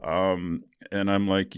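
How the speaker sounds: ordinary talk, nothing marked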